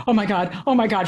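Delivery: ordinary talk, nothing marked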